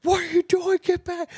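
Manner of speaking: high-pitched imitation of dad yelling, no real volume; mock screaming. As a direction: imitate a panicked coach's yell, held back. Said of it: "he's just screaming at Nora".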